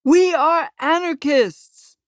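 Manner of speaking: high, loud, slow defiant yell. A narrator's reading. Masked protester yelling at the street, loud and slow. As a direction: shout it like a rallying cry. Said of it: "one yells".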